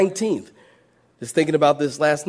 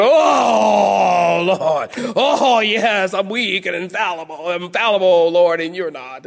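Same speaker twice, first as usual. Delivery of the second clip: mock moaning; slower than usual. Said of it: "you'd have to go over there and".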